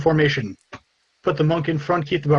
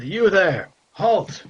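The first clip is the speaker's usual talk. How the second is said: guard calling out; 6 semitones higher, 3 dB louder, much slower than usual. Acted. GM voices a guard challenging.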